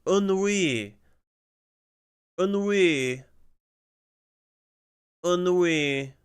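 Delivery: "Ennui" high, with a held "-wee"; practised pronunciation. Pretend. Practising after a pronunciation clip, "Ennui" high and drawn out.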